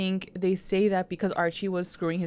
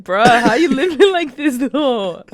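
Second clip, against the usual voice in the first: loud, slow, higher bro voice; 5 semitones higher, 9 dB louder, slower than usual. Retold or imitated. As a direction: drag it out like a jock. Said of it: "Archie's like".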